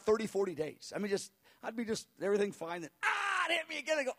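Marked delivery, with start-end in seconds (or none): 3.0-4.0 high-pitched yell as pain strikes; high, startled pained shout; cry of sudden pain. Retold or imitated. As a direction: re-enact being jolted by pain. Acting sudden return of pain.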